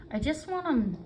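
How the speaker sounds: talking around a pin held in lips; mumbled through a sewing pin; pin in mouth. Real, not acted. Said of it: "sorry, I had a pin in my mouth".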